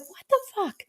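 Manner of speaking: very high, softened squeak; tiny high-pitched cartoon bird voice; little bird voice. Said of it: "That's the energy this week".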